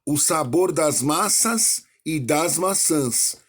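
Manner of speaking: slow reading, contrasting "manhas" and "manhãs", "massas" and "maçãs"; teacher reciting song lyrics slowly for the class; slow, careful recitation. Shown as a demonstration. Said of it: "Por exemplo".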